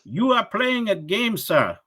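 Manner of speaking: high, slow mock accusing caller; high-pitched caller scolding him for playing a game; scammer voice. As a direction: imitate the scammer calling him out. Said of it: "Comes back".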